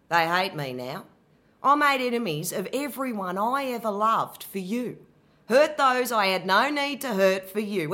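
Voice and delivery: a second, angrier pass at the lament; Medea's anger. Acted.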